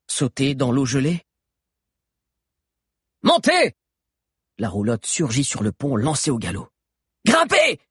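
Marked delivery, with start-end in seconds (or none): 3.2-3.7 high, loud order; urgent shout. A narrator's reading. Mano calling fugitives onto the galloping caravan, urgent shout. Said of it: "cria encore Mano". 7.3-7.8 high, loud order; urgent shout. A narrator's reading. Mano calling fugitives onto the galloping caravan, urgent shout. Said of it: "cria encore Mano".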